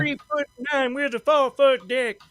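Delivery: sing-song the line, stretched out; mock lyric. Quoting a [name] sidekick's line high and slow.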